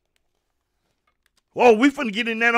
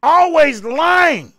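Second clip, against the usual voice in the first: slow angry shout; much slower than usual. Real, not acted. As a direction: spit out each word slowly. Rant on lies.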